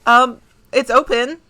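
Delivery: lightly voiced nurse calling; a hint of a woman calling from inside; feminine call. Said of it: "you can hear a feminine voice call".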